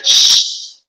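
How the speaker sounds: a whispered shush; mock shushing. The crowd silencing the blind beggar with a whispered shush. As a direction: hush someone sharply.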